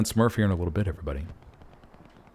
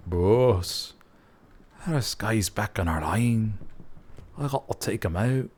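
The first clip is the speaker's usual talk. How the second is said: light mock Scottish accent, slowed; a hint of a Scottish soldier reporting in; 3 dB quieter than usual. T T reporting back to his boss, mock Scottish accent. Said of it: "He'll say it in a Scottish accent".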